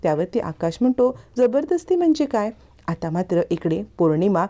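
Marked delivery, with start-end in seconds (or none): 1.4-2.5 put-on confused childlike man. A narrator's reading. Childlike man asks his family what he is accused of, bewildered.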